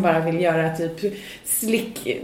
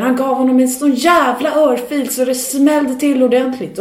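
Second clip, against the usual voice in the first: excited bartender; 5 semitones higher, 6 dB louder than usual. Retold or imitated. Bartender retelling the slap.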